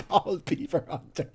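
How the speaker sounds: cracking up at the name; laughing. Shown as a demonstration. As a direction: say it through laughter. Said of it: "laughing at the name".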